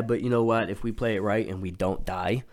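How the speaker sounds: usual speaking voice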